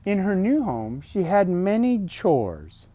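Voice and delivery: bright, lifted storybook voice; reading to children. Acted. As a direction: read it out like a bedtime book. Reading picture book aloud.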